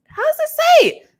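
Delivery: high, loud pitch jump in frustration; exasperated question. Real, not acted. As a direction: throw your hands up at the spelling. Stumbling over a long username.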